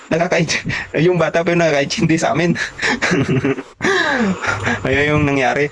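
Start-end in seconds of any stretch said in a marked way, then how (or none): 2.6-4.8 a long, high burst of real laughter. Real, not acted. Tawa sa dulo ng kuwento. (mataas at mahabang tawa)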